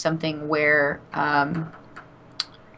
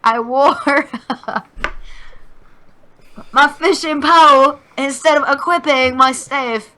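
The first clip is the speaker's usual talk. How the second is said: much higher, louder, slow cartoonish British voice; exaggerated British accent; 9 semitones higher, 6 dB louder, slower than usual. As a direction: go full cartoon Brit, laughing through it. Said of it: "I need to be more rigor morty".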